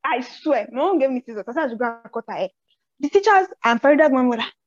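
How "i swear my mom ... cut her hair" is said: ordinary talk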